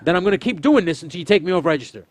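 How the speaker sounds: high, loud challenging tone; defiant rant. Retold or imitated. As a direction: dare the manager to fire you. Retold defiant shouting at manager.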